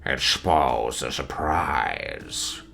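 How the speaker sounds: low, slow, half-whispered demon voice; purring, sinister demon, very slow; gloating demon. Acted. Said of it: "was complain gloating".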